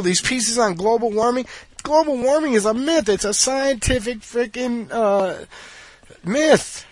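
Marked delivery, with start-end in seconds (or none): 1.8-6.8 mocking. Mocking the global warming claim.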